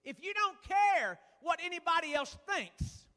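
rising preaching. Acted. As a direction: preach it out to the pews. Preacher building to full force.

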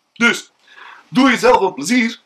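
ordinary talk, nothing marked

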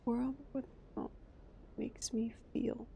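very soft, slow, half-whispered reread; barely audible, trailing murmur; muttering to self. Real, not acted. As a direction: puzzle over the words under your breath.

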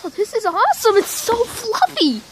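high, delighted squeal; thrilled, voice way up; excited. Real, not acted. Delighted by the snow.

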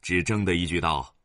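plain reading voice

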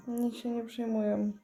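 ordinary delivery

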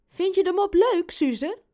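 put-on Robin asks his baby sister about the joke, eager and high; eager question. Ask it high and eagerly.

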